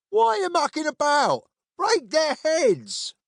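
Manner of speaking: high-pitched soldier's yell; shouting. A narrator's reading. Soldiers urging comrades to attack two travellers, shouting. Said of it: "were shouting at their comrades".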